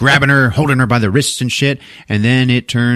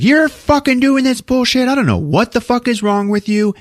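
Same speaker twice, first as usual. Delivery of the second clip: high-pitched, heated mock tirade; acted fighting. Wife starts fighting with husband, acted anger. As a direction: lay into him in a high voice. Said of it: "she starts fighting with him".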